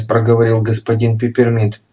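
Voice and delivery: plain reading voice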